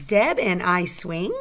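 slow, deliberate question with "swing" rising at the end; question intonation. Shown as a demonstration. Teacher asks pupils if it's a question, questioning. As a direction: lift "swing" sharply upward like asking. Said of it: "Is that a question?".

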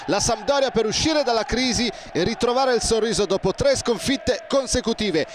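normal talking voice

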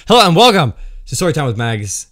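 bright show intro; show host intro. Acted. Restarted show greeting.

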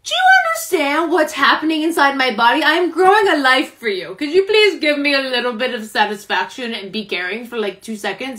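exasperated, hammed-up lecture; retold indignant scolding. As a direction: lay into an uncaring husband, playfully acted out. Retold scolding of joking husband.

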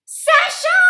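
very high, loud yell across the beach; shout. A narrator's reading. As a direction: call her name out loud. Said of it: "crie Stella".

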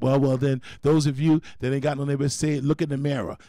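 ordinary talk, nothing marked

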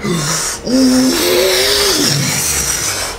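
sharp gasp. Retold or imitated. Storm hits tent.

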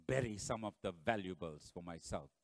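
low, hushed private thought; scheming Achan. Acted. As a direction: mutter it to yourself in secret. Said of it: "Achan decided".

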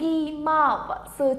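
"Ishogimasu" slowed, each syllable lengthened. Shown as a demonstration. Teacher introducing word number five to learners, syllable by syllable. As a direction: draw out "Ishogimasu" sound by sound.

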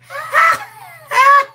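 loud, high laugh with silent gaps; hesitation laugh. Real, not acted. Co-host teased about the laugh, high hesitating laugh. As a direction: burst out, stall silently, then laugh on. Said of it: "That's the hesitation, okay?".

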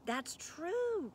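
high-pitched exclamation; crying out. A narrator's reading. Dakin breaking in on the gargoyles in recognition, crying out. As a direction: pipe up, voice leaping high. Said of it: "cried Dakin".

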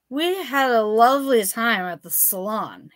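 sloppy and pleased with himself, a bit tipsy; sloshed drunk. A narrator's reading. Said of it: "he sloshed with a little hiccup".

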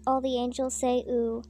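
ordinary delivery

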